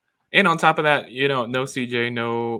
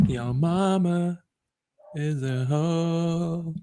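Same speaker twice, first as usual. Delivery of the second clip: slow put-on voice; 7 semitones higher, much slower than usual.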